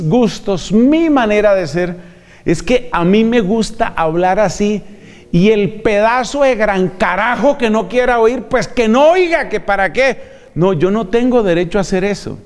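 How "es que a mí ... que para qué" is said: defiant arrogance. Retold or imitated. Careless preacher dismissing his congregation, mock arrogant. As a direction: brush off the listeners like a cocky preacher.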